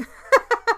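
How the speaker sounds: a sudden, high, loud laugh at her own joke; high burst of laughter. Real, not acted. Laughing at her own lonely joke: a high, loud laugh.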